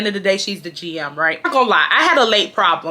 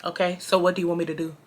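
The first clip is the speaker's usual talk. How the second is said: deadpan; 4 semitones lower, 8 dB quieter, faster than usual. Retold or imitated. Retold response to manager.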